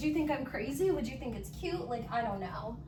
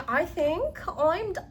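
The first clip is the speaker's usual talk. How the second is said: normal talking voice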